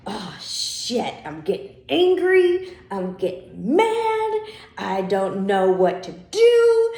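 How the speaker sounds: a hammed-up meltdown, squealing I'm getting mad; mock panicked anger. Coach acts out a triggered student losing it, hammed-up sung panic. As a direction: pitch shoots way up into a near sung wail on angry and mad. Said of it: "and you're like".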